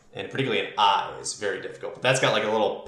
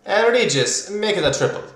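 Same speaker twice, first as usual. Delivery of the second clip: much higher and louder, a showy exclamation; flamboyant high order; 9 semitones higher, 5 dB louder than usual. Acted. Cyber-eyed woman ordering at the bar, high and showy. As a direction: toss off the order with high flair.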